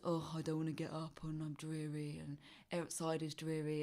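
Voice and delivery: low, soft, droning grumble; mopey whine. Retold or imitated. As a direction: moan it like a reluctant sleeper. Groggy morning inner voice.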